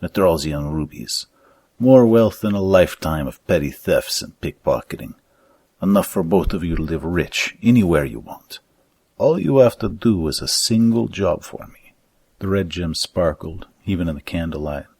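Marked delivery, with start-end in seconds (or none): 0.0-11.9 put-on emphatic pitch in a thick Romanic accent. Fat man tempting thieves with rubies, thick Romanic accent. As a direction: sell the riches in a Romanic accent. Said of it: "in his thick Romanic accent".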